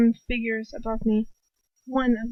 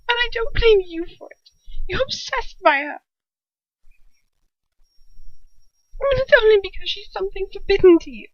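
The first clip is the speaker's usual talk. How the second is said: much higher, louder desperate woman; desperate Bellatrix; 11 semitones higher, 3 dB louder than usual. A narrator's reading. Bellatrix pleading jealously with Lucius. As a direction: plead and justify herself.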